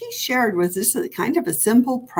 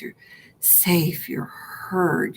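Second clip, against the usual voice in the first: hushed reassurance; 4 dB quieter, much slower than usual. Acted. Soothing self-affirmation.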